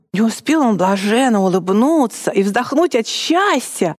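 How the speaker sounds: ordinary talk, nothing marked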